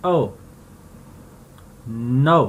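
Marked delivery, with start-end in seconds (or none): 0.1-0.3 ओ said with a firm stress. Shown as a demonstration. Teacher showing the stressed oh. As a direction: lean into ओ. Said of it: "थोड़ा stress दे के बोलना".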